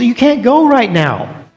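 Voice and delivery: slow, a hint of a calm teacher; gentle Jesus. Jesus telling Peter he cannot come, slow and calm. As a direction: refuse him gently.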